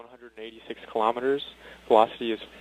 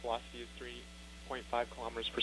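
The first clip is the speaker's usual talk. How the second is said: faint, dropping-out radio voice; like a weak signal from orbit; breaking up space radio; 19 dB quieter than usual. Real, not acted. Callout fading over radio link.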